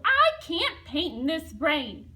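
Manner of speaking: loud, slow grumble; grumbled. Acted. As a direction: complain it crossly from the ladder. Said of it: "the painter grumbled".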